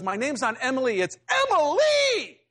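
put-on a small girl correcting the grown-up, ending in a squeal; indignant little girl. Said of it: "She goes".

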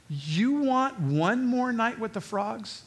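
slow, high, drawn-out disbelief; baffled, with long swooping words; incredulous question. Real, not acted. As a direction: stretch it out in disbelief. Incredulous reaction to Pharaoh's choice.

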